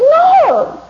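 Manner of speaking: high, loud yelled protest; upset, shouted denial. Acted. Person asked if they are upset yells back in angry denial. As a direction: explode into an indignant yell. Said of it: "Are you upset about something".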